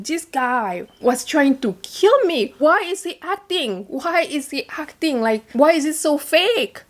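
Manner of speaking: high-pitched indignant disbelief, retold; incredulous. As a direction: replay your baffled inner protest, voice lifted high. Retold alarmed inner thoughts, husband acting.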